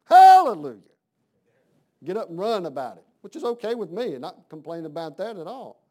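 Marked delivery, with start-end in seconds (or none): 0.1-0.8 sudden loud, high hallelujah; church praise shout. Acted. Acting out Sunday churchgoers praising God, loud praise shout.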